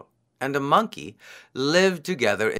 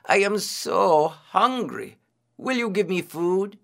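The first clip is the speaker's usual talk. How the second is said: pleading; 8 semitones higher than usual. A narrator's reading. Disguised priest begging the hare for food, pleading. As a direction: stretch the hunger and ask high.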